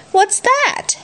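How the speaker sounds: high-pitched storybook character question; high voiced Kipper line; Kipper's question. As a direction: ask it high, as a picture-book character.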